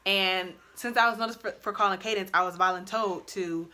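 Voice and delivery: ordinary talk, nothing marked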